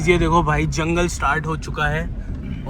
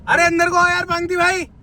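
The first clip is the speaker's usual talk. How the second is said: higher, louder call to a friend in the dark; 12 semitones higher, 4 dB louder than usual. Real, not acted. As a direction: yell him back to the car. Calling friend back inside.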